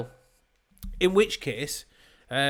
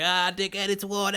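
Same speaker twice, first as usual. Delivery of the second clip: higher, mocking lad voice; cocky drunk lad; 5 semitones higher than usual. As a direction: jeer at security like a smug lad. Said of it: "And they're like".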